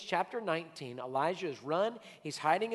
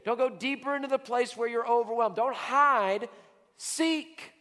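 loud, high preacher's cry; fiery preaching; 4 semitones higher, 5 dB louder than usual. Acted. Pastor urging the church out of hiding, fiery preaching. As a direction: preach it out to the congregation.

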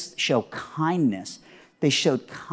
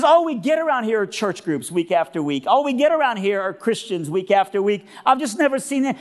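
higher-pitched, marveling woman's voice; high put-on voice of amazement; dumbfounded cleaning lady; 6 semitones higher than usual. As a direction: imitate a baffled hotel cleaner. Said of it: "I'm kinda dumbfounded".

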